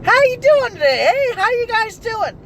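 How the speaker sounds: high-pitched, loud hello from a polite passerby; friendly greeting. Acted. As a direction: sing out an exaggerated neighborly greeting. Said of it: "people didn't have manners back then".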